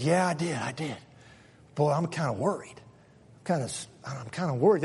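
quiet, hesitant worried man; nervous man. Retold or imitated. One nervous Israelite on Passover eve, quiet and hesitant. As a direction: mumble your doubt anxiously. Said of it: "he said / one guy's nervous".

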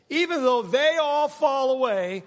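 "they all" pitched high and loud; stress on they all. Retold or imitated. Peter setting himself above the other disciples, loud high stress on "they all". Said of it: "I picture Peter looking at all of the other people".

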